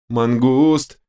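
trembling, pleading cry of a name; fluttering cries for help. Acted. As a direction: call out shaking with fear. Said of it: "дрожащим голосом взмолилась Эдж".

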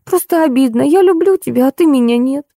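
high-pitched wounded complaint; moaning, hurt. A narrator's reading. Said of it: "простонала Ольга". (обиженно, высоким жалобным голосом)

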